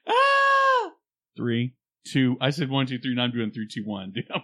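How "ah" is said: short high yelp of frustration; frustrated yell. Real, not acted. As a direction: yell out at yourself. Frustrated at breaking again.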